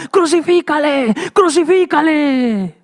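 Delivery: high drawn-out shout; preacher voicing the crowd, high shouting. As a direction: shout it like the whole crowd at once.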